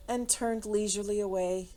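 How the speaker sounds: ordinary delivery, nothing marked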